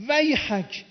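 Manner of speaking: high, loud preacher's outburst; retold cry of rebuke. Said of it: "فریاد زد".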